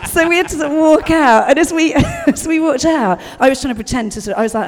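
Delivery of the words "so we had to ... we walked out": higher, louder, laughing through the memory; can barely get it out for laughing. Real, not acted. Laughing recalling embarrassment.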